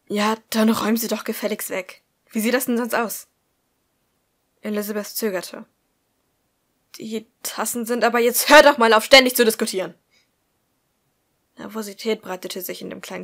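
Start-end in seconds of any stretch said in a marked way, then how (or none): 0.1-3.3 louder, sharp scolding; scolding mother. Acted. Mother scolds daughter about cups. 7.0-10.0 loud furious yell cutting her off; angry shout. Acted. Mother cuts daughter off angrily.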